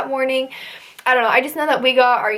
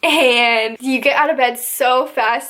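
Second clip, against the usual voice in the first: ordinary talk, nothing marked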